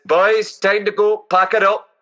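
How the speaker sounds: rally the lads in broad Scottish; Scottish customer shouting to his mates; Scottish accent. Acted. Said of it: "best Scottish accents I've heard".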